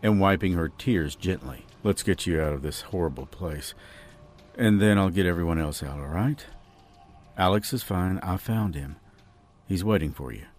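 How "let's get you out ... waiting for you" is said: low, soft man's voice; reassuring rescuer. A narrator's reading. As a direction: reassure her quietly and steadily. Voices Chris reassuring Jade.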